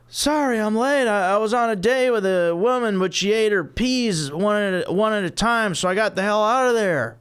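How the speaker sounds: high whiny Seinfeld delivery; high nasal-free observational whine. Acted. Jerry Seinfeld impression high.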